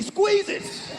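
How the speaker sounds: high, laughing shout; squeezing punchline. Acted. Comic describing the broke dude, laughing and shouting.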